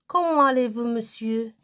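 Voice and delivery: lifted question intonation; questioning tune. Shown as a demonstration. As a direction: ask it clearly, voice lifted on "allez-vous". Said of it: "Attention à l'intonation".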